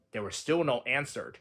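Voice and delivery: plain reading voice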